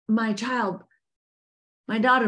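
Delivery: ordinary talk, nothing marked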